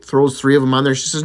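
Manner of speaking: normal talking voice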